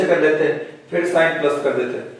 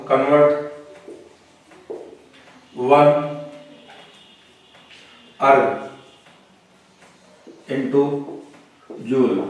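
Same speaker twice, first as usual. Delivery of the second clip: each word spaced out for note-taking; dictating slowly to the class; slow dictation; much slower than usual. Shown as a demonstration. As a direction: read it out word by word so they can write. Teacher dictates problem to copy.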